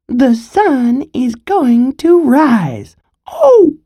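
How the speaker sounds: high, slow, squeaky little squirrel voice; a tiny squirrel piping up brightly; piped up. Acted. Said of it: "Little Brown Squirrel piped up".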